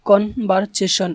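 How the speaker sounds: "Conversation" very slow, broken into its syllables. Shown as a demonstration. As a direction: split "conversation" with a gap after each syllable. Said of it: "এগুলোকে ভাগ করব".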